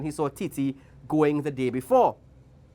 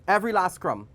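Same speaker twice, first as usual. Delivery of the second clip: ordinary delivery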